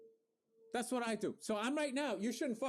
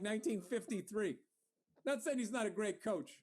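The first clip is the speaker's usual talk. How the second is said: ordinary delivery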